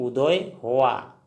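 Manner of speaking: उदय हुआ said very slowly as u-doy hu-a; slow pronunciation demo. Shown as a demonstration. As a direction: model the answer word by word. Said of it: "असमिया में क्या कहेंगे?".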